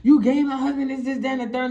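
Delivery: retold tirade, voice lifted; angry confrontation. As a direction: accuse her as the wronged wife. Wife confronts girl at car.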